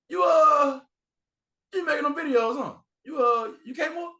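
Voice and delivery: high, loud excited questioning; excited fan. Retold or imitated. Fan quizzing him mid-bathroom, high excited voice. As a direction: hype him up like a starstruck fan.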